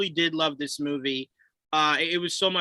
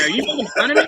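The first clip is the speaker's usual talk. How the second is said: mock Tobey; 10 semitones higher, 5 dB louder, much faster than usual. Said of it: "And he's just like".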